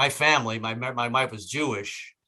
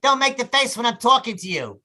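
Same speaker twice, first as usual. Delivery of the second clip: nagging wife; 10 semitones higher, 5 dB louder than usual. Retold or imitated. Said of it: "she always says the same thing".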